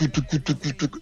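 soft imitated vinyl scratch; scratch sound. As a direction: mouth the record scratch. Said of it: "just like a tuk".

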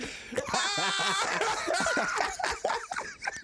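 high squealing laugh; burst of laughter. Real, not acted. Laughing at own joke.

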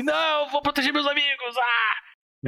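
high, slow heroic anime cry; earnest determined anime hero. Retold or imitated. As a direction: shout it like a shonen hero vowing to protect friends. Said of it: "fala assim".

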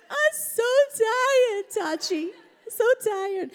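put-on high, drawn-out whiny kid voice; fake toddler whine. Toddler whining to grandma that he's so tired. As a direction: stretch it out like a manipulative toddler. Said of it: "Fake whining and everything".

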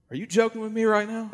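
can't believe what he's hearing; disbelief. Retold or imitated.

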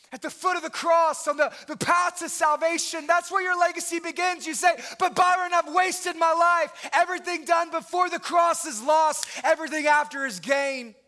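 loud, high, impassioned altar call; full-force preaching. Acted. Preacher urging the congregation to come to the cross, loud and high. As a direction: preach it to the rafters, pleading.

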